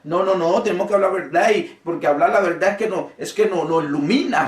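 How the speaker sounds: rising pulpit fervor; fiery preaching. Acted. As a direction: preach it hard to the congregation. Preaching builds to full force.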